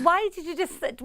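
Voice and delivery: ordinary talk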